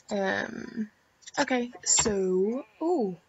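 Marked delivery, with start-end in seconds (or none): none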